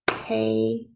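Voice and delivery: "Table" as two separate beats, "tay", pause, "bull"; split into syllables. Shown as a demonstration. Teacher counting syllables with pupils, split apart. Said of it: "let's count the syllables".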